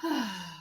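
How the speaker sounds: soft tired sigh; heavy sigh. Acted. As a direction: let out a weary sigh. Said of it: "Peter sighed".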